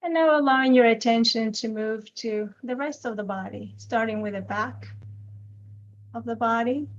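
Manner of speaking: unhurried meditation guide with long silences between phrases; guided meditation. Acted. Teacher guiding a group's attention to the body, calm guided meditation. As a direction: gently lead them into noticing the body, leaving lots of space.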